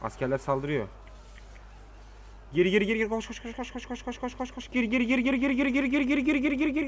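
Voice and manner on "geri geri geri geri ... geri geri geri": being chased. Real, not acted. Fleeing enemy in battle.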